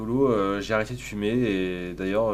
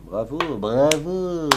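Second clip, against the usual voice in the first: faux triomphe; 3 semitones higher, 3 dB louder, much slower than usual. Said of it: "applaudissez-moi".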